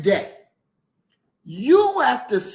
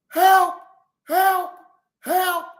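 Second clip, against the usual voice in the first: high-pitched imitated cries, fading in volume; mock scream for help; 8 semitones higher, 3 dB louder, much slower than usual. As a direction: imitate someone calling out for help, one word at a time.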